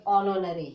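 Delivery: ऑनोनरी opening on ऑ with no h; silent h. Shown as a demonstration. Teacher models honorary for learners. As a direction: say ऑनोनरी without any h. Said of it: "ओ करके बोलोगे".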